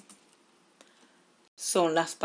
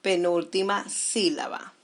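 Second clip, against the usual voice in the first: ordinary talk, nothing marked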